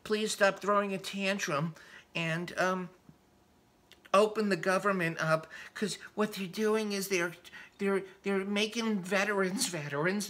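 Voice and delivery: soft, syrupy imitation of a politician's calm plea; mock calm rhetoric. Ranter imitating Schumer's plea to Trump, soft mock-calm voice. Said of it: "very calm rhetoric about saying".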